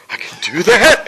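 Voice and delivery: high, loud, giddy retold excitement; can't believe your luck, voice leaping up; starstruck disbelief. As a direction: squeal it like a thrilled fan. Retelling his starstruck reaction to offer.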